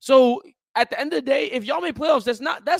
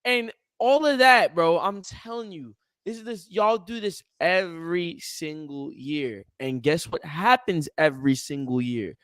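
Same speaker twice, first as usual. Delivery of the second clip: usual speaking voice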